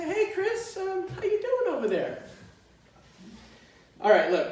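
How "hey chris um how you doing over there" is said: much higher, light and softer, easy concern; breezy wife. Retold or imitated. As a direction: ask casually, not out of breath at all. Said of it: "she says".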